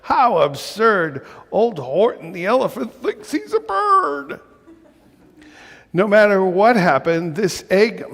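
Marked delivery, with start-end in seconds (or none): 0.0-4.4 much higher mocking crowd voice with a laugh; taunting yell. A narrator's reading. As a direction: jeer at the elephant. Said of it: "they yelled".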